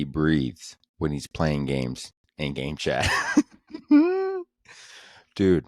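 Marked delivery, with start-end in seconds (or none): 3.7-4.4 high burst of laughter. Real, not acted. Laughing at own joke.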